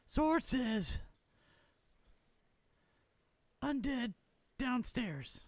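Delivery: high thin sword voice; magic sword voice. Acted. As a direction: speak as the enchanted sword, high. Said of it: "Peter told me what voice to use".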